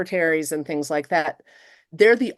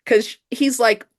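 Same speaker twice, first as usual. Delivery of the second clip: ordinary delivery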